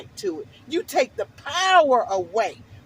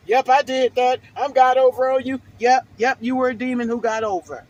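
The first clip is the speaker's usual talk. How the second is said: louder taunting gloat; mock gloating; 6 dB louder than usual. Mimicking the freeloading tenant's gloat, louder. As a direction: throw his boast back at him.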